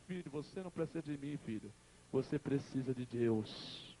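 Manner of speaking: hushed, low reassurance; acted dying mother. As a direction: answer the son softly, slowing at the end. Dying mother soothes her son.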